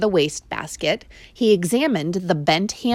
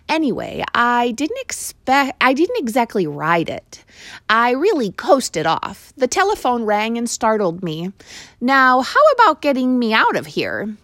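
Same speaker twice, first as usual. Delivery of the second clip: higher, lively mouse; Ralph the mouse; 6 semitones higher, 3 dB louder than usual. Acted. Mouse explaining his crash to the boy. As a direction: talk fast to save face.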